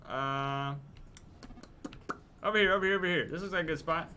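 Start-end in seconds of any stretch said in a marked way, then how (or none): 2.4-3.3 raised voice calling everyone over. Real, not acted. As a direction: call the group over loudly. Rounding up group for photo.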